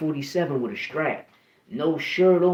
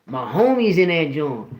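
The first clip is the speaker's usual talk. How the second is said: ordinary talk, nothing marked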